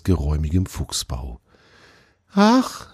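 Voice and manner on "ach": put-on much higher young deer calling out; calling fawn. A narrator's reading. The fawn calls after the fox who walks past, high call. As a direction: call out to a friend, high and bright. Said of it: "sagte Tjawe".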